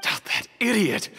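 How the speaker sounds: angry outburst. Acted. Accomplice's confession revealed.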